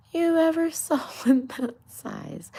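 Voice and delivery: retaking the rabbit line, breaking into a laugh; giggling through the retake. Acted. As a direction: whisper it high and loud while cracking up. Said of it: "I know, it was a bad accent".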